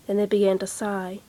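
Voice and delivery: ordinary delivery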